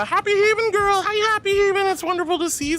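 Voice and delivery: high-pitched delighted hello; excited greeting. Real, not acted. Excited stream greeting to viewer.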